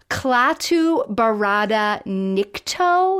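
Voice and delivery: slow, drawn-out "Klaatu Barada Nikto?", each word given weight; "Klaatu Barada Nikto?" stretched out slowly; puzzled movie line. Retold or imitated. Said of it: "but he says to her".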